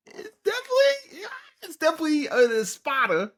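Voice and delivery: fan squeal, voice shooting far up; excited squeal. Real, not acted. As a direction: gush like a fan who just spotted an Espada. Fan spots Espada, squeals.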